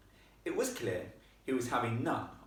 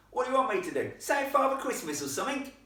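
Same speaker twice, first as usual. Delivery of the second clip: high, loud sarcastic lad; sarcastic Cockney boy; rough boy ghost; 14 semitones higher, 7 dB louder, faster than usual. Acted. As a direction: taunt her in a rough Cockney voice. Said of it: "His accent was infinitely rougher".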